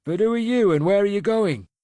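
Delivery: put-on suspicious elderly man's voice; wary old farmer. A narrator's reading. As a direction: demand answers from the stranger. Old man questioning a stranger.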